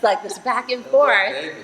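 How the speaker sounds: ordinary talk, nothing marked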